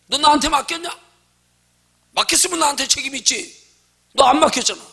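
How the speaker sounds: loud, high challenge from God; loud mock rebuke from God; God's rebuke. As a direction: boom it back at the complainer. Voices God rebuking the complainer.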